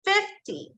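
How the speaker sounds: "fifty" pitched high on FIF, dropping on ty; stress on first syllable. Shown as a demonstration. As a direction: punch the start of "fifty". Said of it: "so I gave the stress now on the first".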